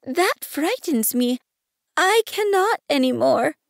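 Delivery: put-on high-pitched, daunted child character; abashed little prince. A narrator's reading. Prince unable to yawn on command, abashed and frightened. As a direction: shrink from the order in a high voice. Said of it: "murmured the little prince, now completely abashed".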